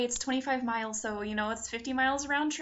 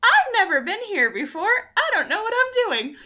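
high, loud, flustered outburst; retold fluster; 10 semitones higher, 7 dB louder than usual. Lost newcomer answering a helper, loudly flustered. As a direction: blurt it out in mock helplessness.

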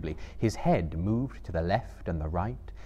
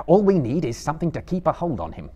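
firm, raised, quicker voice; Frodo; 7 semitones higher, 8 dB louder, faster than usual. A narrator's reading. Frodo tells Sam how to hold Gollum, firmly. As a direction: take charge briskly. Frodo takes charge of captive.

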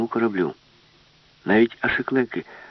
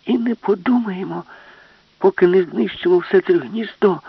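loud, much higher triumphant yell; defiant shout; 11 semitones higher, 6 dB louder than usual. Acted. Alice defies the ship's brain, loud high triumphant yell.

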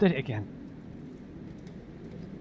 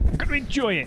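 much higher squealed taunt; shrieked repeat; 11 semitones higher, faster than usual. Acted. Player taunting the game character, high shrieked repeat. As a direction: screech it back in his face.

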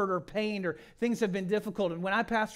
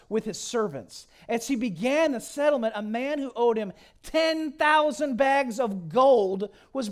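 louder, ringing scripture reading; preaching at full force; 6 semitones higher, 8 dB louder than usual. Acted. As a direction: proclaim the parable to the hall. Reads the parable at full force.

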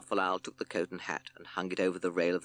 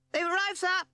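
high, loud sparrow shout, much higher; 5 dB louder than usual. Acted. P C Sparrow shouts to the judge, high and loud. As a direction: call it out high and loud like a little bird. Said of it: "shouted P C Sparrow".

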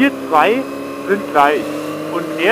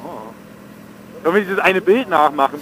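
normal talking voice

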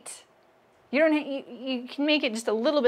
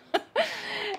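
high burst of real laughter; high giggle; 14 semitones higher, 3 dB quieter than usual. Real, not acted. Laughing at her own dinosaur pun on stream, high giggle. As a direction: laugh at your own joke. Cracking herself up at own joke.

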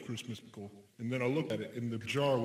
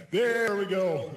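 mock announcement; 6 semitones higher, 6 dB louder, slower than usual. Echoing friend, mock fanfare.

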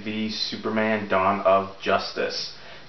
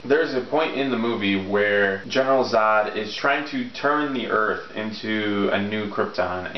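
usual speaking voice

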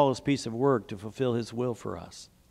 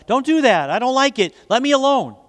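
high, louder storybook yell; yelled protest; 9 semitones higher, 5 dB louder than usual. A narrator's reading. Clay protests to the master, yelling. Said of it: "and I yelled out".

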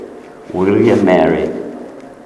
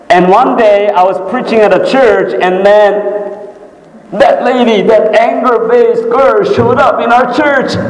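high, animated sermon storytelling; worked-up preacher, pitch held high; impassioned preaching; 12 semitones higher, 4 dB louder than usual. Real, not acted. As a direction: preach it high, building to a reveal.

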